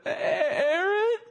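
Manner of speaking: high, cracking, nervous schoolboy stammer; stammering her name like a nervous middle school boy; seventh grade boy voice. Retold or imitated. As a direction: squeak her name out like a shy twelve-year-old. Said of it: "the most seventh grade middle school boy sounding words".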